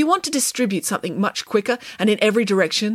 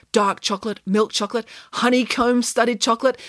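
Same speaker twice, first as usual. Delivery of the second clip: ordinary delivery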